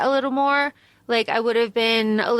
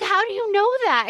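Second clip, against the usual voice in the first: quick, higher, lightly put-on naive Juliet; mock Juliet; 8 semitones higher, faster than usual. Mocking Juliet asking how they know, quick and higher. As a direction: rattle off Juliet's clueless question. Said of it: "She's like".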